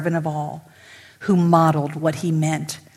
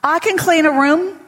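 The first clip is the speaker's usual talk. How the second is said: Haley telling the janitor it's her house, high and firm; insistent Haley; 10 semitones higher, 6 dB louder than usual. Retold or imitated. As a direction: claim the house as hers, higher and louder. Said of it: "She goes".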